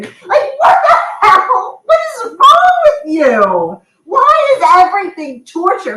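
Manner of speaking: what-the-hell disbelief, pitched high and slow; exasperated outrage. Retold or imitated. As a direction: drag it out in mock fury. Retelling outrage at husband.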